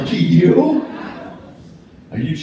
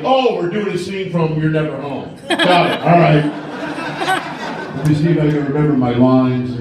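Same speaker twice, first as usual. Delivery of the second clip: resigned husband; 4 semitones lower, 4 dB louder than usual. Retold or imitated.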